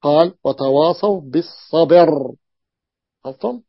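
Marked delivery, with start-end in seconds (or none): 0.4-2.3 slow recitation of وتواصوا بالصبر, the ba of بالصبر with kasra and the ra silent; Quranic recitation with naql. Shown as a demonstration. Teacher to students, reciting بالصبر slowly with kasra on ba, silent ra. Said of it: "بكسر الباء وسكون الراء".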